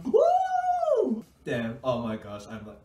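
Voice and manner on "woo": high, loud whoop; victory whoop. Real, not acted. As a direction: whoop like you just won. Celebrating the win.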